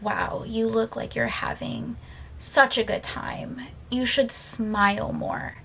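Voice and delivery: slow, soft mocking sarcasm; sarcastic coworker. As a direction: drag it out quietly, imitating a sarcastic man. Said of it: "he very sarcastically looks at me".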